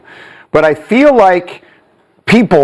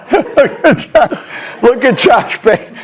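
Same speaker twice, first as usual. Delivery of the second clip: higher-pitched, words broken up by laughter; laughing; 6 semitones higher than usual. Real, not acted. Host to co-hosts after the governor's clip, laughing. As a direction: struggle to talk through real laughter.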